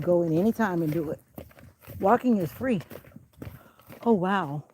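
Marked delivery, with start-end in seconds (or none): none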